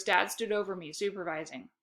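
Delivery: usual speaking voice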